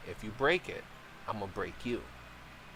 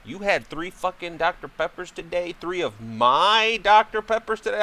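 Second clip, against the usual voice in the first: high, loud, building indignation; mock outraged wife; 8 semitones higher, 10 dB louder than usual. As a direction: imitate an angry wife's rising accusation. Acts out angry wife confronting him.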